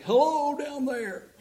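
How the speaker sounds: high, slow, drawn-out hello with a laugh; friendly call from above. Retold or imitated. Balloonist calls down to the couple below, high and laughing. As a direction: call it down like a man hanging out of a basket.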